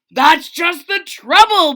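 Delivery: storybook king bellowing. Acted. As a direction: bellow it out in exasperation. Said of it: "bellowed the king".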